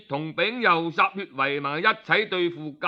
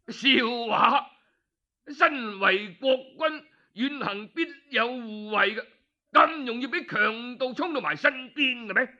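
scornful retort; 6 semitones higher than usual. Acted. Duke scornfully rejects the excuse.

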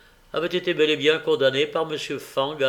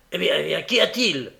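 higher, louder, pressing; impatient; 4 semitones higher, 5 dB louder than usual. A narrator's reading. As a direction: snap at the door impatiently. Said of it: "dit le juif avec impatience".

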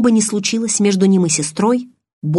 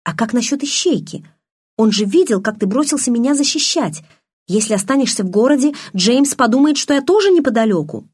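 горячо; 7 semitones higher, 4 dB louder than usual. A narrator's reading. Белла горячо спорит с Эдвардом.

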